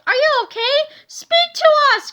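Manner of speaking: put-on frantic high children's cries; panicked cry. A narrator's reading. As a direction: beg him to wake. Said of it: "cried Harold".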